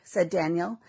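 usual speaking voice

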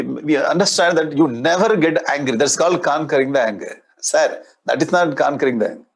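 usual speaking voice

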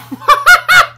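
high, loud cackle; sudden helpless laughing fit; burst of laughter. Real, not acted. Floor kills upside-down player.

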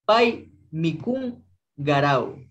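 Pai mikun garau, slow, with a g in garau; deliberate teacher read; slow model pronunciation. Shown as a demonstration. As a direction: spell it out slowly for learners. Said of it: "se le pronuncia con la G".

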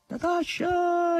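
calling out high and long; a drawn-out, very high call across the sky; high calling cry; shrill high cry. A narrator's reading. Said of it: "gridò Margherita con voce acuta".

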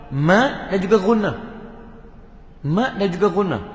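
juga dipanjangkan perlahan; long mad vowel. Shown as a demonstration. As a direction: tarik panjang harakat. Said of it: "dalam soal". (bacaan mad panjang)

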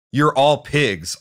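high, loud, slow and furious; spat-out insult. A narrator's reading. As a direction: spit the insult at them. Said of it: "I spat out".

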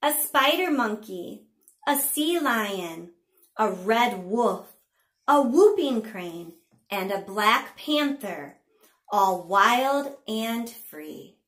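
slow, sing-song picture-book reading; punchy, drawn-out storytime narration; read-aloud to children. A narrator's reading. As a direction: read it to little ones with big pitch swings and pauses.

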